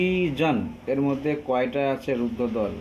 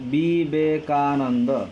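Vivekananda drawn out very slowly, syllable by syllable; 4 dB louder, much slower than usual. Shown as a demonstration. Teacher counting five syllables for students, very slow. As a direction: tap out each syllable slowly. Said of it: "পাঁচটা দল রয়েছে।".

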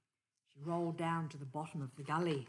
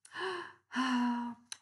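sigh; 6 semitones higher, 4 dB louder than usual. A narrator's reading. Kangaroo sighs.

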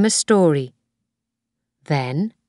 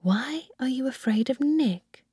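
softer, higher, gentle asking; soft question; 8 semitones higher, 7 dB quieter than usual. A narrator's reading. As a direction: ask the child softly. Nanny gently questions child.

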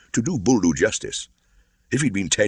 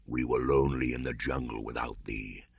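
deep voice; 5 semitones lower, 7 dB quieter than usual. A narrator's reading. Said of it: "a deep voice".

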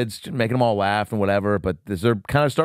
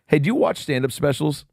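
normal talking voice

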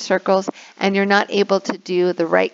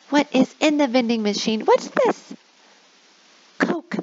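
much higher playful question; playful teacher question; 5 semitones higher than usual. Shown as a demonstration. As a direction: tease the kids with a question.

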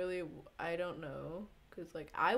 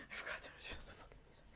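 whispered aside; 11 dB quieter, much faster than usual. Real, not acted. Frustrated muttering while fixing settings.

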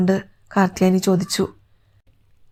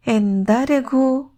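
drawn-out, higher-pitched call to a son; motherly greeting; 5 semitones higher, much slower than usual. Acted. Mother at the mic greets her son, slow drawn-out greeting. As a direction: stretch the name out slowly.

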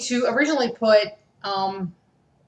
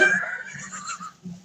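high shrieking laugh of relief; 9 dB quieter than usual. Real, not acted. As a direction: let out a piercing laugh. Relief after challenge.

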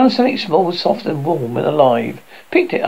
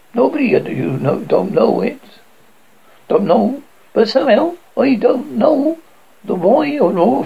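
higher, lilting bargewoman voice; chatty bargewoman; 7 semitones higher than usual. Acted. Bargewoman telling children to fish away, higher chatty lilting voice. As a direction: chat away like a friendly barge wife.

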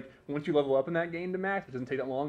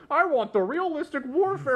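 mocking whine; 11 semitones higher, 6 dB louder than usual. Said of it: "people are like".

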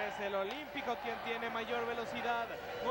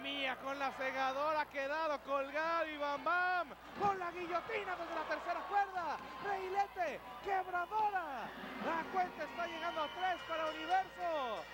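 excited play-by-play, higher pitch; rising live call of the action; wrestling commentator; 6 semitones higher than usual. Acted.

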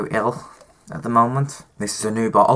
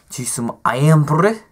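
slow, higher mock Scottish accent; 6 semitones higher, 4 dB louder, slower than usual. Shown as a demonstration. Vlogger showing off a treat in a shaky Scottish accent. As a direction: drawl the brand name in a put-on Scottish accent. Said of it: "I can't even do my Scottish accent".

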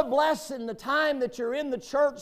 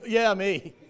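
louder, laughing "Yeah, me"; mock confession; 6 dB louder than usual. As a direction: own up with a laugh. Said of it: "It's kinda like".

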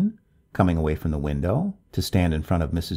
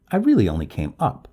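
higher, louder, with a touch of wounded dignity; mildly offended dignity; 5 semitones higher, 5 dB louder, faster than usual. A narrator's reading. Neighbor explains why she came up, with offended dignity. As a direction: stand on your dignity, slightly offended. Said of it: "she explained with dignity".

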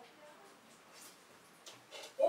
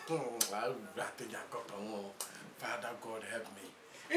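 low despairing prayer; 12 semitones lower, 14 dB quieter than usual. Acted.

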